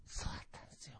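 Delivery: hushed, fully whispered punchline; demonstrating a whispered delivery. Shown as a demonstration. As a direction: drop to a secretive whisper. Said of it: "小声でささやいてる時がある".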